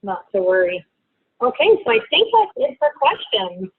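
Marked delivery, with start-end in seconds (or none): none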